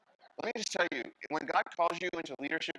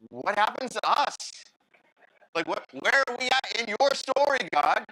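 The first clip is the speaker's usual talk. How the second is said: loud pleading question; 7 semitones higher, 8 dB louder than usual. Pretend. Moses demanding answers from God.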